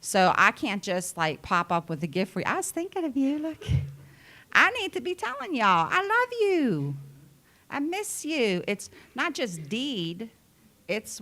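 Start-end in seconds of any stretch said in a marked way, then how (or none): 2.5-8.6 much higher, sing-song loving gush; high sugary "I love you, I miss you"; gushing affection. Retold or imitated. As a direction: act out a sweet over-the-top message to a friend.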